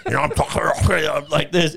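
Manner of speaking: slow, laughing, muffled CPAP talk; cyborg voice through a mask. Shown as a demonstration. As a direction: talk as if oxygen is blowing up your nose. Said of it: "I sound like a cyborg".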